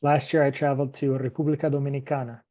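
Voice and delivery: fast, with República Dominicana in Spanish sounds; Spanish name in English. Shown as a demonstration.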